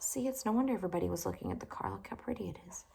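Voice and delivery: soft confiding whisper to the listener; whispered aside. A narrator's reading. Reader confides to the child listening, whispering. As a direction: lean in and whisper. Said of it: "aside to listening child".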